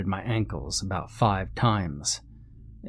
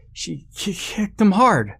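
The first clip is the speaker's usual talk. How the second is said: high stutter, bursting out louder; nervous stammer; 11 semitones higher, 5 dB louder than usual. A narrator's reading. Shaken friend explains the kick, stammering in alarm. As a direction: trip on the word, then push it out slowly. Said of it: "Peter stammered".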